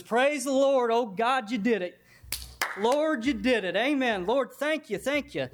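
high, jubilant praise; rejoicing old woman. Acted. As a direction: pour out thanks like an overjoyed old saint. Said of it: "and she goes".

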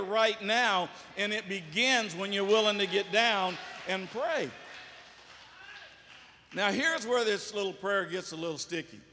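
ordinary talk, nothing marked

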